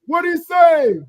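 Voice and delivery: high, loud call; call shout. Retold or imitated. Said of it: "you gonna hear".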